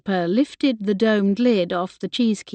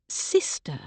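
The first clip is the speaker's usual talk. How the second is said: soft echo; 5 semitones lower, 7 dB quieter than usual. A narrator's reading. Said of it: "echoed through her mind".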